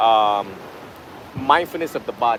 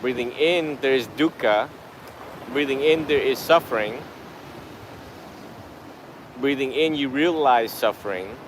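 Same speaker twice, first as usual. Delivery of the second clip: guided meditation; 6 dB quieter than usual. Real, not acted. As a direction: guide the breath unhurriedly, phrase by phrase. Leads a breathing meditation.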